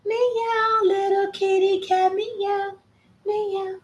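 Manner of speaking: high meowing to a kitty; imitating Donna's meows. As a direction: meow up high like Donna does. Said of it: "My girl Donna says".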